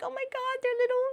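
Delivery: very high, tiny cooing squeak; squeaky gushing. Acted. Gushing at baby hamster emotes, squeaky. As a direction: melt over something adorable in squeaks. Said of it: "It makes me go like".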